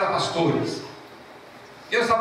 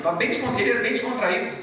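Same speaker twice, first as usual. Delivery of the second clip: usual speaking voice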